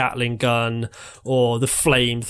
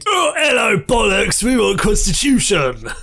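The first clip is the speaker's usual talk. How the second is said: high, hammed-up yelling; mock idiot shouting; 7 semitones higher, 3 dB louder than usual. Joining in as idiots running around demanding the Constitution, mock shouting. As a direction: holler it in a silly high voice. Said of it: "those idiots running around shouting".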